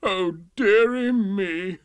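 long drawn-out, loud wail; hammed-up, slow sobbing complaint; mock sobbing. A narrator's reading. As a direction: lament like a pampered king. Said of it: "The King sobbed".

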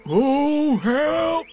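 high, loud wail of alarm; cry for help. Acted. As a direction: call out as if falling. Pooh falling from the tree.

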